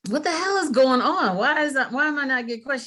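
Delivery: high, loud, fed-up demand; exasperated outburst. Retold or imitated. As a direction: throw up your hands, how is nobody answering.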